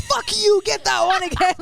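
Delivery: hammed-up competitive yelp; mock aggressive outburst. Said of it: "she goes from, like, tying, like, thoughtful presents to going".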